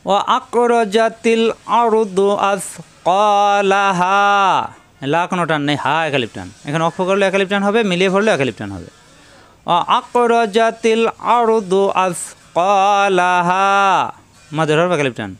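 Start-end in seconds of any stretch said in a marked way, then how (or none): 0.0-4.2 slow melodic chant of the verse; Quran recitation. Shown as a demonstration. 9.7-13.7 slow melodic chant of the verse; Quran recitation. Shown as a demonstration.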